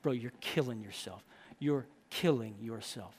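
low, quiet, deliberate correction; stern rebuke. Acted. Brother grabbed by the scruff, low grave rebuke. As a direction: pull him up short, voice dropped low. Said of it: "You ever speak like that?".

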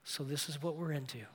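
soft, low gentle fatherly words; a quiet, tender father's voice. Retold or imitated. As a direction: say it softly, with no blame. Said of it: "he said very gently".

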